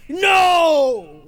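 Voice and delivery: high shouted no; loud no. Real, not acted. Podcaster correcting himself, loud shout. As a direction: slam the word down. Emphatic outburst.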